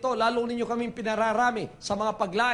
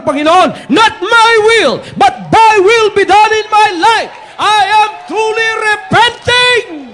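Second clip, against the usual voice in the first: preaching at full force; 3 semitones higher, 14 dB louder than usual. Real, not acted. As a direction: shout the altar call to the crowd. (buong lakas na pangangaral, sumisigaw)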